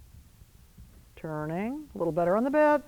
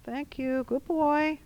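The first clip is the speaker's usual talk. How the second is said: higher, soft praise for a good horse; higher, soothing thanks to the horse; praising the horse; 6 semitones higher, 3 dB quieter, much slower than usual. Shown as a demonstration. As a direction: thank him gently and mean it.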